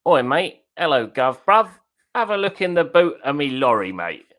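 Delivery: put-on Cockney voice mocking the stream; silly Cockney impression. Shown as a demonstration. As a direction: ham it up like a London wide boy. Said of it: "My best impression".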